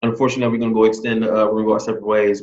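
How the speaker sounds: fast, mocking a canned rejection; rattled-off form email. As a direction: rush through it like boilerplate. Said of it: "copy and pasted email".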